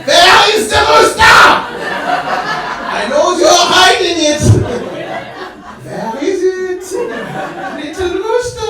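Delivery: high, loud hammed-up villain shout; menacing inspector. City inspector as film villain searching, loud. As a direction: play the movie villain hunting the rooster.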